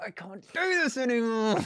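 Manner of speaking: high, hammed-up moan of defeat; despairing wail. Catchphrase of despair after the score.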